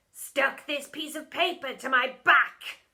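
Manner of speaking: slow, loud cheeky reply; cheeky boy voice. A narrator's reading. Bog owning up to his prank, cheeky voice. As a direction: tease the teacher.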